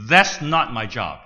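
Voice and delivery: firm, loud self-righteous answer. Retold or imitated. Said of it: "in a strong voice, self-righteous voice".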